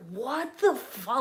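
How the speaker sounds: high, drawn-out what the; slow, stretched shock; stunned disbelief. Retold or imitated. Sisters hearing the man's creepy excuse, slow and stunned. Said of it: "we were like".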